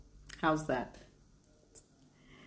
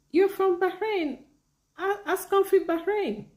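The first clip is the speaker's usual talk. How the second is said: high, delighted exclamation; excited discovery; 13 semitones higher, 4 dB louder than usual. Real, not acted. Streamer finding a viewer is from Bahrain, excited. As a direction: exclaim it high in happy surprise.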